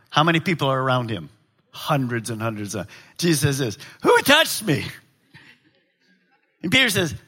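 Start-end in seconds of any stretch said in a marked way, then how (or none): 4.0-4.8 high-pitched startled question; startled demand. Acted. Preacher as Jesus asking the crowd who touched him, startled. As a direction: call it out to a whole crowd.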